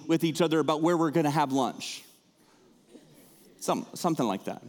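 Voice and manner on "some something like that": ordinary delivery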